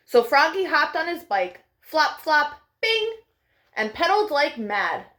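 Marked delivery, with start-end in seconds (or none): 2.8-3.2 bing. A narrator's reading. Bike sound effect.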